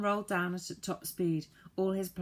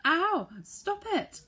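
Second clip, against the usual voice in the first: very high, louder cries to stop; frantic shout; 13 semitones higher, 7 dB louder, much slower than usual. A narrator's reading. Saucepan man being attacked, shouting very high to stop. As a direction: yelp stop it like being hit. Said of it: "shouted the saucepan man".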